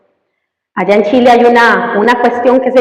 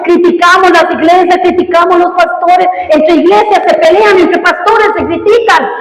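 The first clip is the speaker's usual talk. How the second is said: raised, driving pulpit voice; fiery preaching; 6 semitones higher than usual. Acted. Pastor urges his congregation to compassion, fiery preaching. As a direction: preach it hard and pushing.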